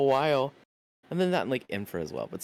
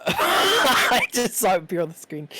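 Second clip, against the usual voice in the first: laughing; 8 semitones higher, 5 dB louder than usual. Real, not acted. Laughing hard while talking.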